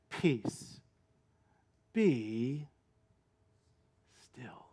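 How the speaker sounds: soft, fading command to the sea; Jesus hushing the storm; hushed command. Retold or imitated. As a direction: let it die away into stillness.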